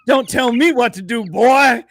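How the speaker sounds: put-on loud, high Southern-accented Siri; mock Southern telling-off. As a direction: scold like a Southern voice assistant. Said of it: "the Southern accent, and it'll just be sitting there calling me boy".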